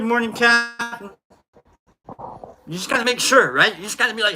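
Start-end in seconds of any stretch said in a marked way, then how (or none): none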